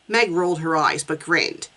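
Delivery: ordinary delivery, nothing marked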